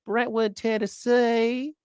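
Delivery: lightly mock her Southern accent; imitating a senator's Southern accent. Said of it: "That's why she talks like that".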